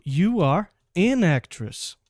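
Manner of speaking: slow, with "an" clearly linked to "actress"; stress on an. Shown as a demonstration. Teacher modelling "an" before a vowel, very slowly. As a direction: lean on the word "an". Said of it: "Por exemplo".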